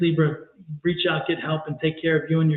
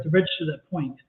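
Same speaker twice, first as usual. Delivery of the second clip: normal talking voice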